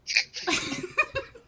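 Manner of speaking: high, loud burst of laughter. Real, not acted. Game master cracks up mid-scene, laughing. Laugh after the character's question.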